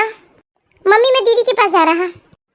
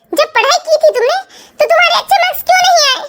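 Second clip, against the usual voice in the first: angry scolding; 5 semitones higher than usual. Acted. Mother scolds child over marks.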